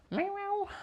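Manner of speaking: high mock meowing; cat meow. Real, not acted. Greeting the cat Dahlia with a high meow.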